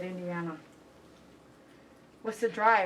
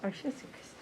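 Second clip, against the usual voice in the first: whispered cooing; 10 dB quieter than usual. Real, not acted. Cooing at the dog.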